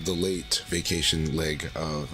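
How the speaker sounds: normal talking voice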